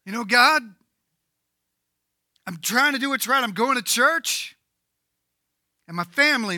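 loud, high complaining to God; mock the frustrated believer's moaning. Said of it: "I think God would understand this".

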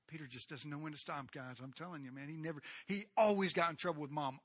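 usual speaking voice

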